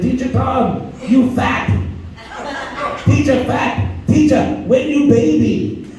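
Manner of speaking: lightly voiced kids yelling a taunt. Eight-year-olds calling out to their teacher, mock shouting.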